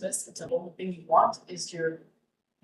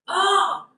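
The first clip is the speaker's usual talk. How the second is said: high, loud mock yelp; acted-out squeamish yelp; imitated yelp; 12 semitones higher, 6 dB louder than usual. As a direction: imitate a sudden cry of "ah". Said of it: "he's like".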